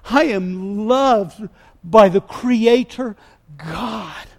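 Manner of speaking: shouted, high declarations with drawn-out key words; building preacher's shout, stretched on the last words; impassioned preaching. Real, not acted. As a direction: proclaim it at full force, word by word. Preaches at full force.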